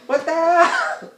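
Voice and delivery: loud outraged shouting at the game; high, loud cry of unfairness; frustrated yell. Real, not acted. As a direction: yell it like the game cheated you. Game setback, yelling at screen.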